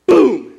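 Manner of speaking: loud, high imitated boom; crash sound. Car hitting the basketball goal, a loud imitated boom. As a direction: slam out the crash noise.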